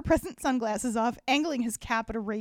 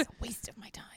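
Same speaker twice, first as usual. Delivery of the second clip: whispering; 10 dB quieter, faster than usual. Real, not acted. Mock bored aside.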